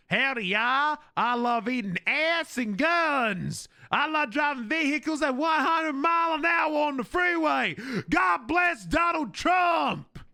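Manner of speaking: hammed-up Southern American shout; loud caricature of a patriotic American; mock American accent. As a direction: holler it in a slow, high American drawl. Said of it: "turning into your stupid fucking accent".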